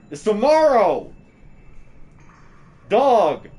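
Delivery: high, loud excited outburst; excited yelling. Retold or imitated. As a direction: yell it in disbelief at a friend. Retold excited outburst at viewer.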